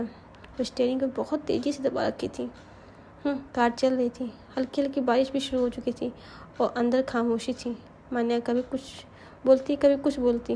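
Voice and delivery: ordinary delivery, nothing marked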